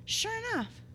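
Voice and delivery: high, soft 'huh'; light, high-pitched dawning surprise; mock realization. Preacher acting out a sinner's easy admission, mock realization. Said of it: "All you have to do is go".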